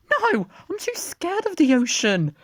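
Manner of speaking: very high squeaky timid voice; high scared voice. Acted. Dippy refusing to swim, high squeaky scared voice. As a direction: squeak it out, frightened.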